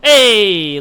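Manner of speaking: usual speaking voice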